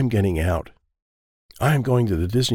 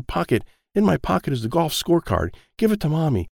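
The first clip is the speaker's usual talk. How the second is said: ordinary delivery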